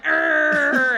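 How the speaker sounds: loud growling bark like a dog; dog snarl. Retold or imitated. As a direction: snarl it out like an angry little dog. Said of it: "He grabbed my shoe and went".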